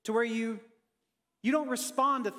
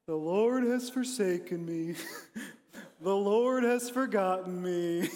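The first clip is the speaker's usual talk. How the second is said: gloomy Eeyore voice; 4 semitones lower than usual. Acted. Preacher playing Israel as Eeyore, lightly mopey. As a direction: sigh it out like a defeated Eeyore. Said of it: "you have to do it in that voice".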